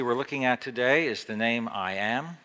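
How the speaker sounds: ordinary delivery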